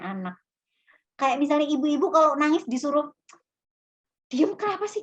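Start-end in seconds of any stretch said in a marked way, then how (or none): 4.3-5.0 mock snappy scold. Mimicking someone scolding crier.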